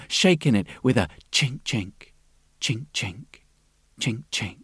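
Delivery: soft chinking sound. A narrator's reading.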